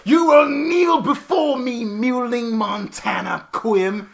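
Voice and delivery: slow shouted villain voice; dark lord. Retold or imitated. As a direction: boom it like a dark god. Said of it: "And he's like".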